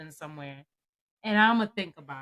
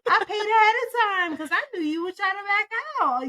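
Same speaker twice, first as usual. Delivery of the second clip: higher, louder, heated mock rant; angry retold rant; 9 semitones higher, 5 dB louder than usual. Retelling a fight with her, a hot mock rant. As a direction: vent at her like you're furious all over again. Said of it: "I'm like".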